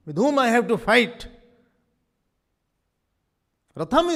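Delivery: a hint of loud, high battlefield appeal; Arjun's call. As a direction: ask boldly, reciting the verse.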